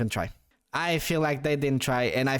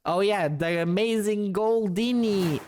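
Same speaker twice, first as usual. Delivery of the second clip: higher, grand stage magician announcement; show wizard; 4 semitones higher than usual. Acted. Streamer introducing Goldini as a stage act, show wizard. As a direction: announce the act with a drawn-out flourish. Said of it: "Sounds like a show wizard to me".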